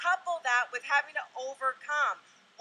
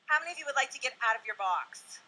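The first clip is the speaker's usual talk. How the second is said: usual speaking voice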